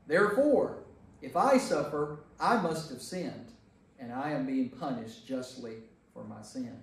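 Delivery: ordinary talk, nothing marked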